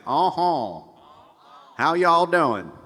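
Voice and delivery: high, loud Southern twang. Shown as a demonstration. Coach demos pharyngeal resonance with a Southern twang. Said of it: "if you went down south and you got a little bit of twang".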